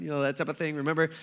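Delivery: ordinary delivery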